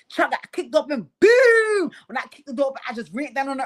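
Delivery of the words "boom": high, loud drawn-out boom; big high-pitched boom; imitated impact boom. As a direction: shout the door-kick sound effect. Acting out kicking door open.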